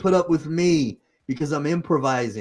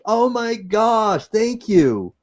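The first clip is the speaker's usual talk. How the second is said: Oh my gosh drawn out slowly and much higher; delighted surprise; 8 semitones higher, 5 dB louder, much slower than usual. Real, not acted. Host thanks a viewer who shared everywhere, surprised and delighted. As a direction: gasp of glad surprise, then thanks.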